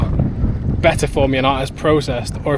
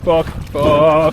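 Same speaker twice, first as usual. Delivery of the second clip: shaking; slower than usual. Real, not acted. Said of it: "I can't stop shaking now".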